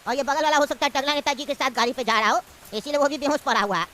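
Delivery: put-on low, easygoing old uncle. Uncle explains the unconscious men.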